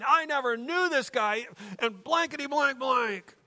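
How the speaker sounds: high-pitched acted cursing outburst; mock salty rant, pitch well up; swearing denial. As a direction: deny it hotly like a swearing sailor. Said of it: "He swore at her".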